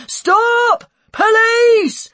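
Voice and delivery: very high, loud drawn-out yell; shouting. A narrator's reading. Policeman thundering after a runaway boy, shouting. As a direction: bellow a police order to halt. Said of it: "Chris shouted".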